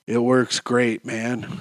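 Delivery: low, quiet, doped-up reply; on Valium. Retold or imitated. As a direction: answer as if heavily sedated. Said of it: "when I was on Valium".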